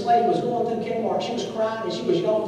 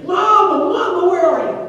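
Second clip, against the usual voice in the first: put-on even higher, louder, panicked child's scream; the lost boy shrieking for mama at full force; really screaming; 11 semitones higher, 8 dB louder than usual. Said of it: "now he is really screaming".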